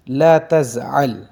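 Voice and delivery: ला तज़अल said slowly and loudly; slow model phrase. Shown as a demonstration. Teacher modelling the Arabic phrase slowly and clearly. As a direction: sound out "ला तज़अल" slowly for students. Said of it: "अगर आपने किसी को कहना हो कि".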